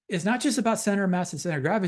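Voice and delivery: usual speaking voice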